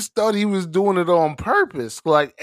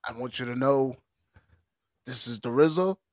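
lightly voiced rapper, measured and deliberate; 4 semitones lower, 7 dB quieter, faster than usual. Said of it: "I had the rizza cadence down".

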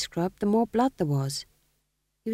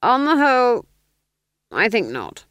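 louder, clipped aristocratic command; cold Duke; 6 semitones higher, 7 dB louder than usual. Acted. Duke dismissing the sergeant: louder cold command. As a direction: dismiss him like a bored lord.

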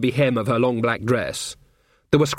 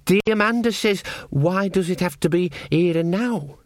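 put-on higher, lightly anxious young voice; nervous young witch; 6 semitones higher than usual. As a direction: pass on a friend's question uneasily, pitched up. Nervous young witch questions Nanny.

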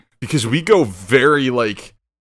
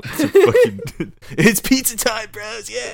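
hyped, high surfer-turtle voice; Mikey surfer dude; 10 semitones higher, slower than usual. Retold or imitated. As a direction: do a party-dude Ninja Turtle. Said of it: "Like from T M N T".

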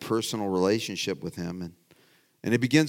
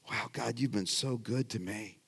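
soft awed prayer; 10 dB quieter than usual. Retold or imitated. Voiced grateful prayer to God.

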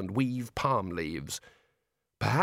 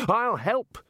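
much higher, louder shout; 9 semitones higher, 4 dB louder than usual. A narrator's reading. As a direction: call out eagerly. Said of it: "shouted the Dean".

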